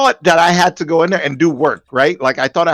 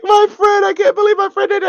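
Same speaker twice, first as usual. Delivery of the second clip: imitating a sobbing friend, far higher, fast at the end; mock crying friend. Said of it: "She's, like, crying".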